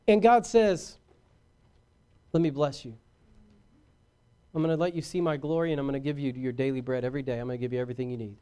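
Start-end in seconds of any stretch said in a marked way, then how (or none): 2.3-8.4 soft, low voice of a giving God; gentle reassurance. Acted. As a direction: drop low and quiet, promise it tenderly. God's gentle answer to brats.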